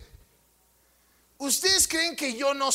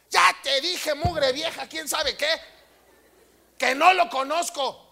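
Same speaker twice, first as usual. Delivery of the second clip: a touch of a rough Veracruz-style curse, higher and pushed; cursing Peter; 3 semitones higher than usual. Acted. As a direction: act out Peter snapping back with a curse. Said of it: "Ahora sí hablaste naturalito". (Pedro maldiciendo con un leve toque veracruzano, más agudo)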